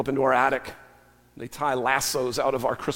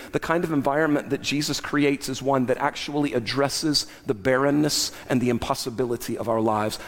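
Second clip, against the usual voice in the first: ordinary delivery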